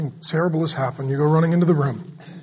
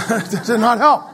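laughing; 6 semitones higher, 7 dB louder than usual. Real, not acted.

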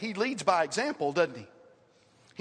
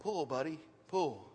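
soft, lower, gentle command; real quiet; 4 semitones lower, 7 dB quieter than usual. Retold or imitated. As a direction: barely say it, calm and close. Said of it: "Then real quietly he said".

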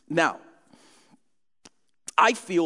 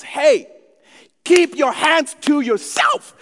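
loud, slow, higher scold from the front seat; mom yelling; 8 semitones higher, 8 dB louder, slower than usual. Retold or imitated. Mother yelling back at the kids in the car, loud. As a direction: bark the order back at the kids. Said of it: "yells at us".

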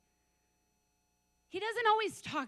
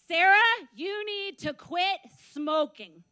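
firm, slow, louder command; retold divine yell; 4 semitones higher, 12 dB louder, slower than usual. God telling a smoker to quit, stern retold yell. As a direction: spell it out slowly with authority, imitating a yell. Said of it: "I heard a yelling".

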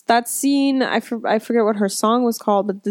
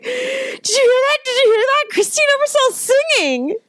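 excited squeal; faster than usual. Retold or imitated. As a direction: squeal it to a friend in giddy disbelief. Retelling an excited aside to a friend.

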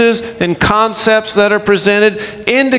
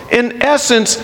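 ordinary talk, nothing marked

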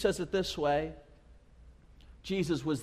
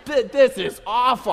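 put-on loud, higher disgusted kid; mock disgust; 5 semitones higher, 7 dB louder than usual. Kids tasting seaweed, revolted. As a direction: splutter it out in hammed-up disgust.

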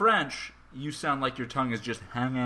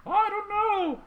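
high silly voice; slower than usual. Pretend. Joke setup in a put-on voice.

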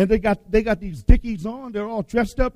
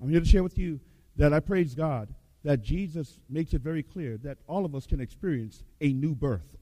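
normal talking voice

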